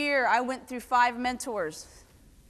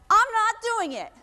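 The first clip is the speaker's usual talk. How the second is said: defiant refusal; 9 semitones higher, 3 dB louder than usual. Retold or imitated. Sailor's defiant reply, retold.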